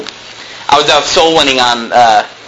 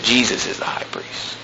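quiet rebuke; 5 semitones lower, 12 dB quieter than usual. Retold or imitated.

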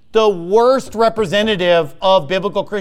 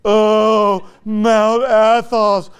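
reverent mock awe; much slower than usual. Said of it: "it's just like".

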